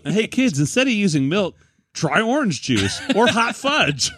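high, bright-pitched mock cereal ad voice; co-host jokingly sells cereal to kids in a hammed-up ad voice. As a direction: pitch it like a Saturday morning cereal commercial.

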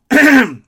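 a sharp, loud throat clear. Real, not acted. Podcast host clearing his throat while signing off. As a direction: clear the throat hard mid-sentence. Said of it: "I wasn't getting emotional".

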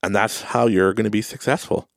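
normal talking voice

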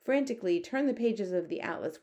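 ordinary delivery

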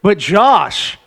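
slow, dramatic results reveal; winner announcement. Acted.

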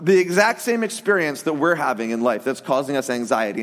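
normal talking voice

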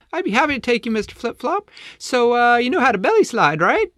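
very high, loud, eager penguin; squeaky little penguin, keen to help; Layla. Acted.

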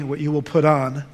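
ordinary delivery

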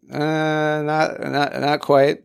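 retold reluctant "not quite"; awkward hedging. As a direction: hesitantly break it to her. Acts himself awkwardly correcting the mom.

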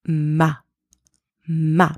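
"ma" alone, plain M and open ah; "ma" modelled clearly with an ah vowel; isolated syllable demo. Shown as a demonstration. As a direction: say "ma" as a separate syllable, then repeat it. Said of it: "So in our first syllable".

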